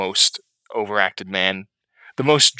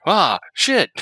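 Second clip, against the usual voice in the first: high-pitched mock outcry; imitated yell; 9 semitones higher, 3 dB louder than usual. Retelling the villain dropping his act, imitated high yell. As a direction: blurt it out like a cover slipping. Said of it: "he just yells out like".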